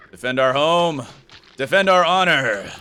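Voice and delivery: rally the troops, full voice; rallying cry. Acted. Rallying call to a spider pet.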